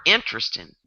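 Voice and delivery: Southern "interesting", squeezed to intrestin; Southern accent. Shown as a demonstration. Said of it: "and then the South".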